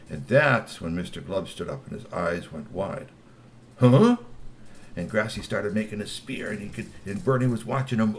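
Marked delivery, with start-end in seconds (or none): 3.8-4.2 startled high huh; sudden alarmed gasp of a word; surprised huh. A narrator's reading. Mister Glove surprised.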